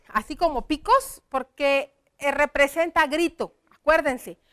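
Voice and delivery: ordinary talk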